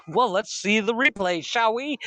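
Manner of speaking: mock challenge. Voices wife's challenge in an argument.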